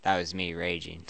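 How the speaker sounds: low, soft, offhand; casual aside. Real, not acted. Player commenting on the rant just typed, casual and low. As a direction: drop it casually, low and quiet. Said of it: "I just said that so casually".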